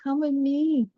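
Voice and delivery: lightly voiced begging bird; pleading swallow. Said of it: "begged the swallow".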